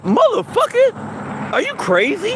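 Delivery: high-pitched, drawn-out disbelief; acted incredulity. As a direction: voice jumps up, stretching the first word. Acts out his incredulous inner response.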